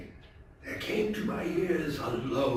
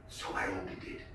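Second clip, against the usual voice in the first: soft unvoiced whisper; hushed and careful; stealthy whisper; 9 semitones lower, 9 dB quieter than usual. A narrator's reading. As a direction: whisper as if opening the lantern unseen. Stealthy act.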